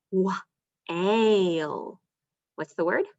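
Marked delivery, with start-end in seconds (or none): 0.1-2.0 "Wa-ile" split into onset and rime; sounding out. Shown as a demonstration. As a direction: segment the word for the kids. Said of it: "let's try a new one".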